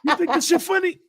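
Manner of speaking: high-pitched, loud indignant demand; angry retold father. Father confronts laughing son, high mock-furious yell. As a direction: imitate a furious dad yelling.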